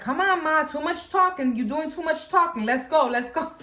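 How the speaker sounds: high-pitched, hurrying complaint; retold impatient nagging. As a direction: nag Mom to get moving, half-joking. Hurrying mom who keeps talking.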